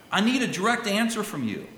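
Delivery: high, insistent acted plea; urgent prayer. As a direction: demand an answer, pitch lifted and punched. Acting out people's urgent prayers.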